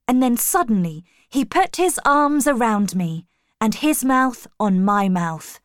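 ordinary delivery

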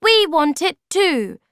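put-on high, loud childish retort; squeaky stubborn Thingamy; defiant small creature. Thingamy replies.

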